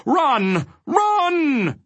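high-pitched calling shout. A narrator's reading. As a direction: stretch the word out high, urging them on. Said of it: "it shouted again".

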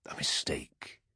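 hushed, low, menacing whisper; soft whisper full of danger; dangerous whisper. A narrator's reading. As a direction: whisper it as a threat. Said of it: "Perturabo's voice dropped to a dangerous whisper".

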